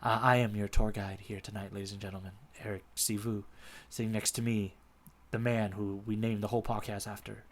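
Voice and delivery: low, soft, smooth radio host; late night DJ voice. Acted. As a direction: purr it close to the mic. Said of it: "I get into my late night D J voice".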